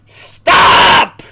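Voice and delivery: very loud, harsh scream; real outburst, begging it to end; screamed protest. Real, not acted. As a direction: scream it out in one long burst. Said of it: "I screamed".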